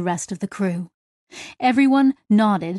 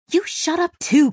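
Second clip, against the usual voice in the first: irritated retort; 8 semitones higher, 3 dB louder than usual. A narrator's reading. Acted quarrel among the crew.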